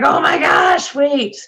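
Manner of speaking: high, loud startled exclamation; alarmed doctor. Retold or imitated. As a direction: imitate a doctor's loud sudden realization. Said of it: "she was like".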